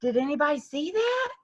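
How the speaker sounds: mock excited student. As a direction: show off the great shot. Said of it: "the fifth one is".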